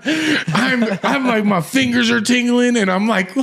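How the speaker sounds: high, squeaky-pitched words breaking into laughter; giggling retelling. Retold or imitated. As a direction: relive a laughing fit as you tell it. Retells uncontrollable giggling, laughing again.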